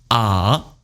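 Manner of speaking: low AH that dips, then turns up; turning third tone. Shown as a demonstration. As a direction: dip "ah" low and bring it back up. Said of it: "Third tone turning".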